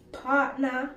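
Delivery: ordinary delivery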